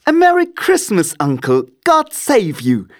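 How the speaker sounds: very high, loud cheery nephew; cheerful cry. A narrator's reading. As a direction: call out a hearty, high-pitched greeting. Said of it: "cried a cheerful voice".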